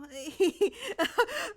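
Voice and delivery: shrill nervous laugh of a trapped man; desperate high laugh. A narrator's reading. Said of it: "a low laugh".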